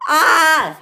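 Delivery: high, loud "ah" scream. Acted. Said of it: "he screamed".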